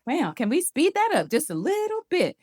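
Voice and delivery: high, disbelieving inner voice; lightly voiced impatience; mock exasperation. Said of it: "But I'm thinking".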